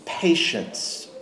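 a quiet, hushed repeat of Patience; soft echo. Acted. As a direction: let the word settle softly, almost to yourself. Repeating the quoted word after pause.

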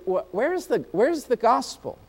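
stammered, mildly baffled question; lightly acted bewilderment; puzzled questioning. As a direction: ask it haltingly, pitch lifting on WHERE'S. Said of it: "and you say".

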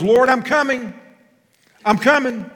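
high, loud eager answer; eager cry. Pretend. Preacher voicing the heart's eager reply to God. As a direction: call out gladly like a servant answering.

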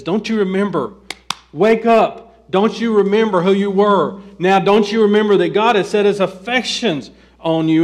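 loud, insistent preaching at full force; rousing plea. Acted. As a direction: shake the congregation awake. Preacher voices apostle's rousing plea.

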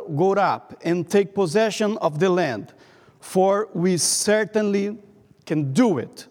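normal talking voice

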